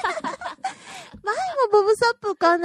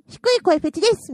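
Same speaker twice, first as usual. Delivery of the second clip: louder, higher, quick announcement; mock declaration; 5 semitones higher, 6 dB louder, faster than usual. Friend imagining herself confessing her fetish, mock declaration. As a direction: blurt it out like a proud confession.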